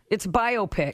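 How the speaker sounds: "biopic" as BIO-pic; corrective pronunciation. Shown as a demonstration. Host correcting a co-host's word, "biopic" said BIO-pic. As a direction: say "biopic" as bio plus pick. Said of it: "you sound like such a rube".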